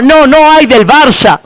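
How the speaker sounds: normal talking voice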